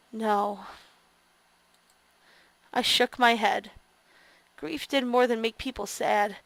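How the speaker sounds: low, soft narration read; story narration. A narrator's reading. Player quietly reads the narration aloud.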